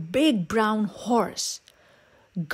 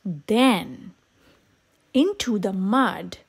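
ordinary delivery

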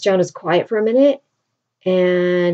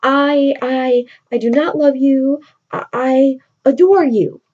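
eager correction; 6 semitones higher than usual. Retold or imitated. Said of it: "he's like".

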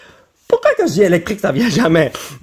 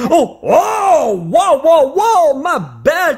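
higher, slow, drawn-out wows of delight; 6 semitones higher, much slower than usual. Real, not acted. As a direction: savour the find, stretching every wow. Delighted at a good card.

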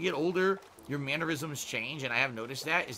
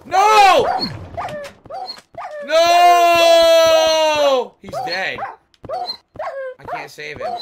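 loud, very high cry of no; anguished shout; 16 dB louder, much slower than usual. Real, not acted. Streamer wailing as his pet dies in the game. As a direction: scream at the loss.

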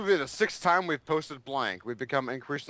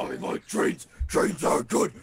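meme voice; 5 semitones higher than usual. Pretend. Meme voice, then sung tune.

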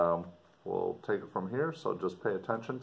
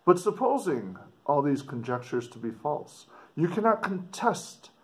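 raised, expressive letter reading; dramatic reading; 4 semitones higher, 5 dB louder than usual. Acted. Teacher reading the explorer's letter aloud to students, expressive. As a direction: read it out to the class with feeling.

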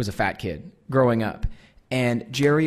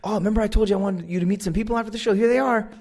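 higher, quick excited chatter; eager introduction; 8 semitones higher, faster than usual. Retold or imitated. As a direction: rattle it off brightly to present someone. Jerry eagerly introducing his parents.

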